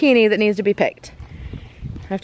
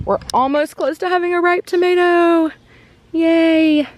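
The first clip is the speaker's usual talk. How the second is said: higher and louder little cheer; excited; 9 semitones higher, 3 dB louder than usual. Real, not acted. Showing viewers an almost ripe tomato, excited cheer. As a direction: squeal with delight at the tomato.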